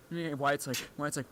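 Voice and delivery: ordinary delivery, nothing marked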